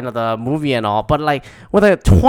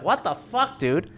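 incredulous; 7 semitones higher, 6 dB quieter than usual. Retold or imitated. Incredulous reaction to the family plot.

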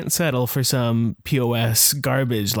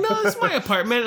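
high defensive protest; 13 semitones higher, faster than usual. Retold or imitated. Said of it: "I'm like".

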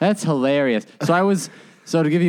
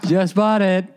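mock douche voice; 6 semitones higher than usual. Mock voice of the guy.

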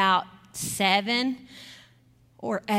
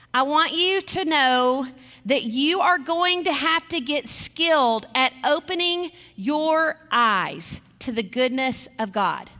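usual speaking voice

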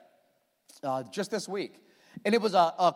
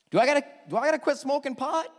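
put-on higher, faster eager young voice; young man's eager question; 9 semitones higher, 5 dB louder, faster than usual. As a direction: fire it off like an eager kid quizzing the pastor. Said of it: "the young man says to the pastor".

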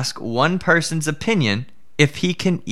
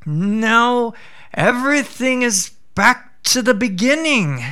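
higher, pleased little jackal; a clever jackal springing the trap; triumphant jackal; 9 semitones higher than usual. Acted. Jackal locks the tiger in, higher and pleased.

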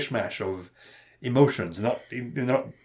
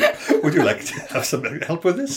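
high, chirpy helper; chirpy Clippy voice; 6 semitones higher, 3 dB louder than usual. Retold or imitated. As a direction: sing it like a cheery assistant.

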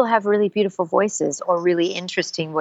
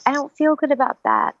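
higher, more upset read of the line; second take, higher and more distressed; a different emotion; 8 semitones higher than usual. Shown as a demonstration. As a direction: let the hurt push the pitch up. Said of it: "implement different emotions".

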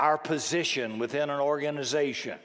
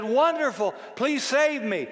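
high, raised pleading call; calling out in plea; 9 semitones higher than usual. Acted. Man on the branch begs God, calling out.

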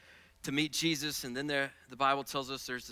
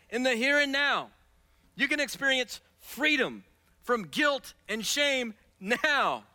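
preaching at full force; 11 semitones higher, 9 dB louder than usual. Acted. Pastor voicing Jesus to the congregation, preaching loud. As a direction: lift every phrase to the back row.

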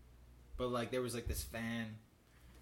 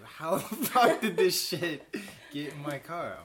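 slow, loud, laughing mock bewilderment; baffled and cracking up; laughing disbelief; 5 semitones higher, 10 dB louder, slower than usual.

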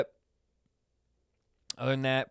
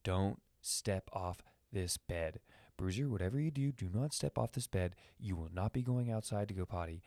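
dog's inner voice; 3 semitones lower, 8 dB quieter than usual. Pretend. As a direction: talk yourself into staying put. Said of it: "see him talking to himself".

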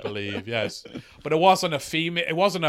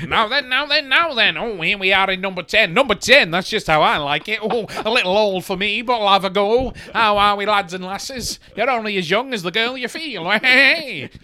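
louder, higher lecherous Boris; mock Prime Minister bluster; Boris Johnson impression; 4 semitones higher, 5 dB louder than usual. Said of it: "That's my Prime Minister".

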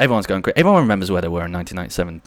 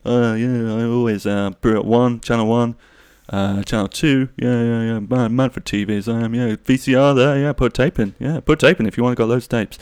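manly dad voice. Retold or imitated. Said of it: "like, manly".

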